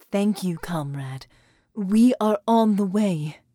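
slow, carefully measured formal voice; slow formal speech. A narrator's reading. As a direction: speak each word deliberately and formally. Sarah speaks, slow formal voice.

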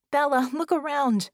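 high, loud urgent cry; exclaiming. Acted. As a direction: call out in alarm. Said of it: "Vicky exclaimed".

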